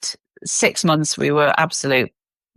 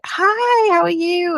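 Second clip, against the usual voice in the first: high, bright-pitched hello; excited greeting; slower than usual. Retold or imitated. Greeting her partner at the airport escalator, high excited hello. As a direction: call out happily, pitch way up.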